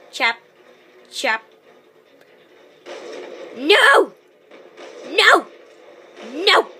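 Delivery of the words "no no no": high, loud repeated no; dismayed shouts. Real, not acted. Gamer reacting to a setback in the match, dismayed shouting. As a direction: cry out each no, pitched up sharply.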